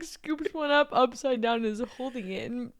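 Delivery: ordinary talk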